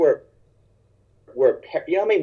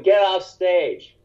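mock heckle; 4 semitones higher, 3 dB louder, much slower than usual. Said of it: "This woman starts booing".

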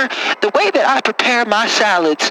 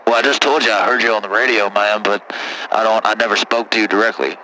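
put-on much lower, rough-talking good ol' boy; deep caller voice; 12 semitones lower than usual. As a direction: play a low-voiced country caller. Same caller voice, fast start.

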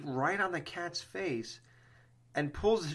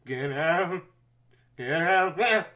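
slightly louder urgent warning; imitating Loomis; 3 semitones higher, 5 dB louder, slower than usual. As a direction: warn them off, urgent but contained. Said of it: "Loomis goes".

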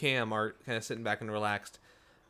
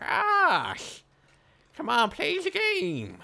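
vulture carny voice; 5 dB louder than usual. Acted. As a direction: lure them in like a creepy vulture. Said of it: "Now he kinda sounds like".

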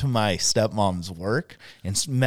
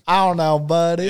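put-on a touch of a Southern accent, a little higher; 6 semitones higher, 4 dB louder than usual. Friend repeating the Southern soldier, light Southern accent. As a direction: echo him in light Southern. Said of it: "He'd be like".

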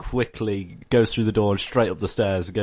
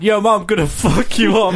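put-on cheeky kid voice; 8 semitones higher, 4 dB louder than usual. Kid swearing at his mom.

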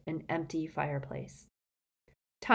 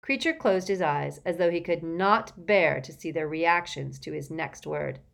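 ordinary delivery, nothing marked

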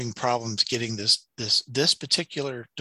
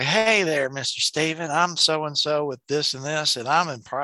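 put-on Shreveport accent; 4 semitones higher than usual. Consultant phones the complaining customer, in a Shreveport accent. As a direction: imitate a Shreveport Southern accent. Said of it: "it is that Shreveport accent".

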